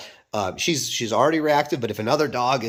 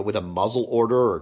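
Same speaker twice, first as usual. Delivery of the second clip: usual speaking voice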